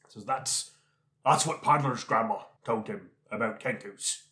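normal talking voice

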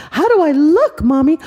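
very high, loud eager question; eager little girl. Acted. Little girl asking Mommy how she looks, high. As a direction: ask like an excited child.

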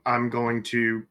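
ordinary talk

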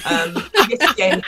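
laughing. Real, not acted. Laughing on recalling tracks.

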